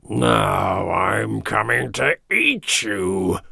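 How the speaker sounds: slow, drawn-out troll roar; big slow ogre-like growl. A narrator's reading. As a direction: stretch the words out like a menacing troll. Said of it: "roared the troll".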